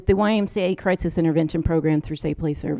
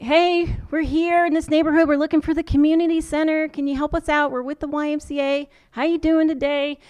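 higher, beaming overly friendly greeting; friendly American tourist voice; 7 semitones higher than usual. Retold or imitated. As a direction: put on a big smile and chirp it up high. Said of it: "I put on a big smile".

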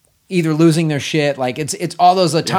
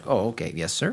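lower, softer mock-polite secretary; respectful assistant; 7 semitones lower, 7 dB quieter than usual. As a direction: [name] playing a meek assistant. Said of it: "So he's so respectful".

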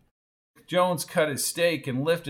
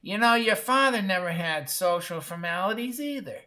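high, scratchy sneer; screechy maternal put-down; 5 semitones higher than usual. A narrator's reading. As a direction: sneer at your son in a screechy voice. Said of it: "She sneered at her son".

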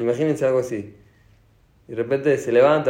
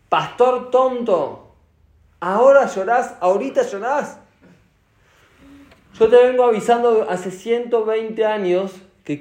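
higher and louder, reproaching; scolding rebuke; 7 semitones higher, 5 dB louder than usual. Retold or imitated. Hashem reproaches Noah for crying late, loud scolding. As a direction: scold him for waiting too long. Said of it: "Antes de eso le dijo".

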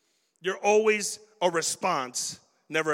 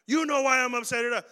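angry retort; 5 semitones higher than usual. Retold or imitated. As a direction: throw it back as the offended one. Acted angry person in scenario.

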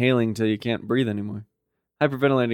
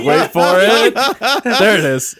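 louder, rising "wait for it"; eager anticipation; 6 semitones higher, 5 dB louder, slower than usual. Retold or imitated. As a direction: build up the reveal, then land it. Editor spotting a gasp coming.

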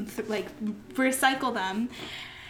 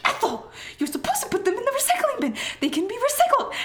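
yelling; 11 semitones higher, faster than usual. Retold or imitated. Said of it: "Mia, stop yelling".

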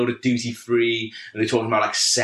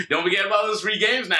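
pestering seatmate, pitched up; mock a repeated, higher-pitched nudge; douchebag from LA; 7 semitones higher than usual. Seatmate nagging about free games, mock douchebag. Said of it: "he just kept saying it all the way".

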